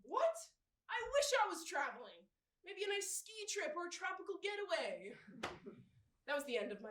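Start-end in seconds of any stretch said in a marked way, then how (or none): 0.1-2.2 high, loud mock protest; raised-pitch comic disbelief; indignant surprise. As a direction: react baffled, then protest. Acted protest at the referee's call.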